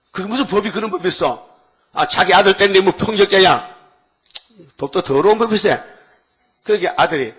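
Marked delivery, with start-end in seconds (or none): 0.1-5.8 louder, indignant protest; raised indignant sermon voice; indignant outburst. Real, not acted. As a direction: rail against an unjust law. Pastor protests the law indignantly.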